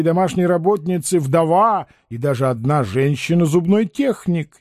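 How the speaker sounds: usual speaking voice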